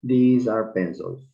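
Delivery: slow, clear teacher demo of These are pencils; slow model sentence. Shown as a demonstration. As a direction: spell out These are pencils word by word for learners. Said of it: "Quiero mostrar estos objetos".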